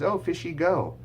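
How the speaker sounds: high, bright modelling voice; gentle sing-song to a toddler; parentese recast. Shown as a demonstration. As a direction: say it cheerfully for the child. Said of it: "I might recast that as".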